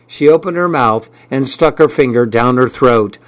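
plain reading voice

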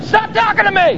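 high-pitched angry outburst; heated protest. Acted. Son fleeing his father, heated and high. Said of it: "You got a little heated".

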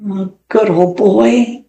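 slow, understated sad fondness; mildly sad. A narrator's reading. Boy patting his transformed dog, mildly sad. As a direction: draw it out with a touch of sorrow. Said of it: "said Alan sadly".

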